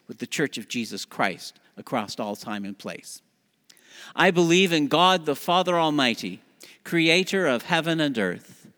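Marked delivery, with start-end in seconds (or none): 4.2-8.7 louder, higher unison recital; creed recitation. Acted. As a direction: lead the creed together.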